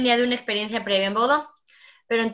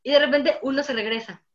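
normal talking voice

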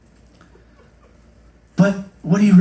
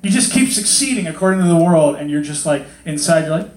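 usual speaking voice

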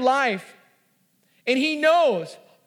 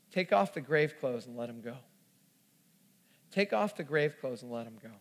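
much lower, hushed scripture quotation; quiet and solemn; 11 semitones lower, 10 dB quieter than usual. Acted. Jesus tells them to free Lazarus, quiet and solemn. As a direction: let the command land softly and gravely.

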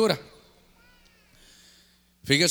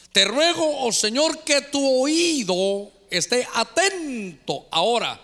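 solemn prayer reading; 6 semitones higher, slower than usual. Acted. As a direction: plead to God as you read. (lectura de oración suplicante)

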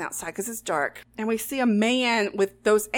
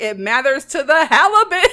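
loud, higher-pitched retold outburst; mock outraged shout; 4 semitones higher, 11 dB louder than usual. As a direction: bellow it as the exasperated chef, hammed up. Retelling chef's furious outburst.

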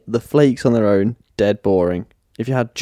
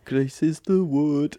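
put-on Brummy accent; 3 semitones higher, 6 dB quieter, slower than usual. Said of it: "Brummy Grease".

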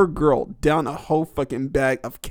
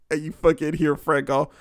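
usual speaking voice

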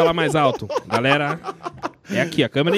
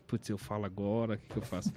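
low, hushed inner panic; quiet retold panic; hushed worry; 9 semitones lower, 14 dB quieter, faster than usual. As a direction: whisper it to yourself, worried.

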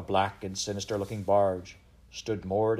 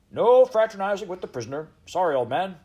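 loud, high-pitched officious guard; guardsman; 10 semitones higher, 9 dB louder than usual. Acted. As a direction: call out the rule to a prisoner. Guardsman scolds and moves prisoner.

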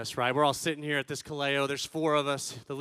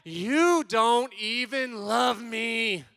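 high, loud, drawn-out wail; hammed-up angry tantrum, stretching the words; mad kid yelling; 6 semitones higher, 6 dB louder, much slower than usual. Dad imitates mad son stringing words together, wailing yell. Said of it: "He's just mad".

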